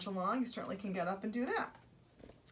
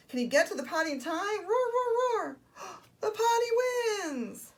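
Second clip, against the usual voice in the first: high-pitched breathless race commentary with roars; gasp, cheer and roar like it's a race; urgent excited storytelling; 13 semitones higher, 8 dB louder, slower than usual. Acted. Storyteller urges dinosaur to reach the potty in time, high and urgent.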